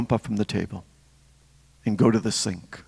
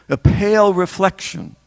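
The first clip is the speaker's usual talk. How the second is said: "pale" pitched high and leaned on; stress on pale; 7 semitones higher, 4 dB louder than usual. Real, not acted. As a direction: punch the word "pale" higher than usual. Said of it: "we're emphasizing the word pale".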